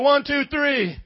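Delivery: loud count-in; shouted count. Shown as a demonstration. Pastor counting the congregation in. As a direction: shout the cue to start. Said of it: "now you say it".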